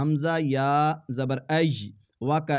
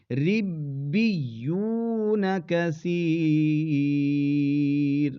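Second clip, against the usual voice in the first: very slow, drawn-out melodic recitation; tajweed chant; Quran recitation; much slower than usual. Shown as a demonstration. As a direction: chant it slowly. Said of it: "recites finished word".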